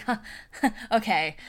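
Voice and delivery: imitating a flustered little laugh. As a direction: laugh awkwardly through a flustered reply. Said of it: "just real flustered".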